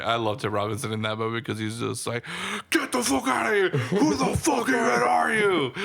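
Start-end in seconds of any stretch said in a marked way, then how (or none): 2.7-5.7 high, loud mock scream; a hammed-up furious scream at a stranger; screaming imitation. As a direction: yell it like you're losing it, voice cracking. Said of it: "like, screaming and".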